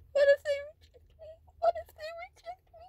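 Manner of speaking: high, strained sobbing question. Real, not acted. Vlogger afraid of rejection, sobbing high. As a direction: let the fear of rejection break through in sobs.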